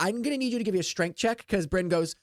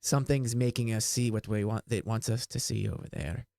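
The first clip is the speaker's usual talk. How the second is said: low, hushed-toned elf voice, softer; wood elf; 7 semitones lower, 4 dB quieter than usual. Acted. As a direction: drop low for an ominous elf. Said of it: "And he goes".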